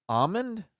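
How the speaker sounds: ALMOND as AH-mund, pitched high. Shown as a demonstration. Host doubting the rule, ALMOND queried high as AH-mund. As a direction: question ALMOND without its L. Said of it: "without the L".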